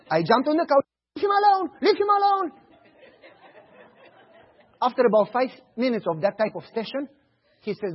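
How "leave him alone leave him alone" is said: high, loud fake pleading; boy joining the staged screaming, high and loud; mock screaming. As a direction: scream along for show.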